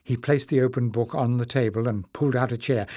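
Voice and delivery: ordinary delivery, nothing marked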